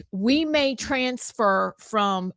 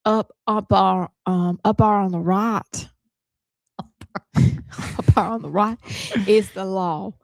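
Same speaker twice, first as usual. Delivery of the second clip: mildly voiced Alabama gas-station man; a hint of a rural Southern man warning; light Southern accent quote; Southern drawl. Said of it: "one of the men said".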